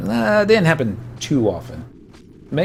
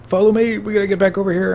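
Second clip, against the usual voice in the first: high, urgent retold command; mock rallying voice, raised high; imitated leader's call; 8 semitones higher, faster than usual. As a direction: call a friend back, pitched up. Retold leader calling the player back.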